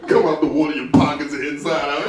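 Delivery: ordinary talk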